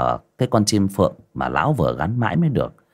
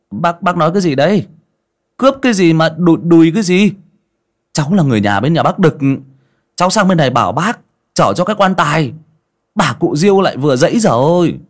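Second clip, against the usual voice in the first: higher, quick, indignant explaining; bewildered protest; 9 semitones higher, 5 dB louder, faster than usual. A narrator's reading. Young man explains his errand to his mistaken accuser, bewildered protest. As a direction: protest fast and loud in confusion.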